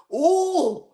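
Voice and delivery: high, startled little "Oh!" in mock surprise; startled gasp. Retelling the woman spotting him, mock startled. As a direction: imitate her jumping at the sight of him. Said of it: "she seen me and she goes".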